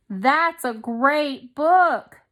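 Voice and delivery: high, loud and slow with delight; excited exclamation. Shown as a demonstration. Teacher demonstrating an exclamation to the class, excited. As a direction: gush over the book. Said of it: "that is an exclamation sentence".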